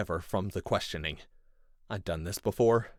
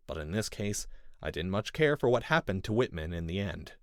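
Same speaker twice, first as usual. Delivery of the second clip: usual speaking voice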